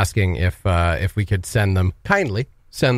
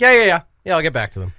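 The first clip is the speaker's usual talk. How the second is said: breezy brush-off; 7 semitones higher, 3 dB louder, faster than usual. Retold or imitated. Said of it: "He was like".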